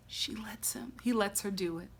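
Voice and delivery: hushed, breathy whisper. Real, not acted. Fan hushing as the couple finally get intimate, whispered. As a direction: lean in and whisper it.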